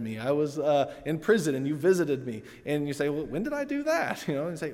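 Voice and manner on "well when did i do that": lightly acted surprise, pitched up; mock-innocent "when did I?"; a hint of baffled protest; puzzled question. Listeners question Jesus in parable.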